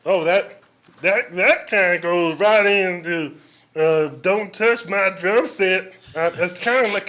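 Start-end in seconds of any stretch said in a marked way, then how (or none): 4.2-5.9 loud, slow, nasal cartoon dad drone; hammed-up Cleveland Brown impression. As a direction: drag it out like a dumb cartoon dad. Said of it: "kinda sounds just like Cleveland Brown".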